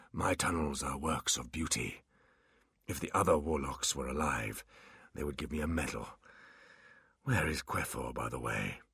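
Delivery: put-on low, hushed old warlock; proud old wizard, half whispered.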